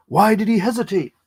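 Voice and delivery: puzzled character's question. Retold or imitated. Quoting Tony Curtis's character after the duel, puzzled.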